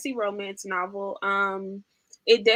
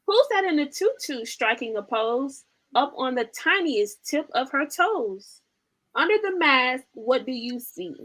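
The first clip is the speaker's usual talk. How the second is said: lively, sing-song storybook reading; animated picture-book read-aloud; reading to children; 3 semitones higher than usual. Acted. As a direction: read it like bedtime for toddlers.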